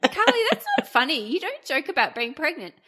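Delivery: high, disbelieving inner monologue; stunned inner voice. Retold or imitated. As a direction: replay the shock in your head. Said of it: "everything that was going on in my brain".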